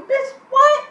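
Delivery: high, loud, stunned what; shocked disbelief. Real, not acted. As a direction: shriek it in disbelief. Shocked by the sister's story.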